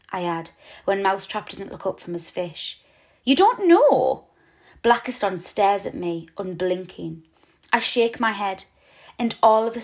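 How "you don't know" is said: high, slow surprised question; astonished. A narrator's reading. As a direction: ask in disbelief, drawn out. Owl amazed, staring.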